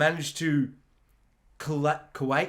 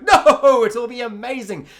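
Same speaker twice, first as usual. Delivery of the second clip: much higher and much louder enthusiastic no; booming cheerful dad; 7 semitones higher, 9 dB louder than usual. Retold or imitated. Dad brushing off his daughter's worry, loud and high. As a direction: bellow it with over-the-top confidence.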